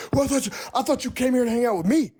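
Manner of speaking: imitating the boyfriend's petulant outburst; mock tantrum. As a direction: throw a hammed-up hissy fit. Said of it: "And he was like".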